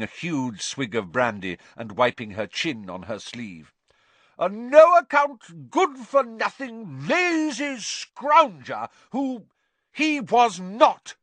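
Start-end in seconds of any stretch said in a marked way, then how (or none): 4.4-9.5 very high, loud, slow, spitting out each insult; loud, slow, contemptuous tirade; scornful Aunt Marge. A narrator's reading. Marge abusing Harry's father. 9.9-11.2 high, loud, slow, defiant; furious boy contradicting; Harry's sudden retort. A narrator's reading. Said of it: "said Harry suddenly".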